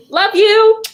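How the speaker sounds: high, loud send-off; sung-out, lifted sign-off; affectionate goodbye. Real, not acted. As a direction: call out a bright farewell to the group. Calling out goodbye to group.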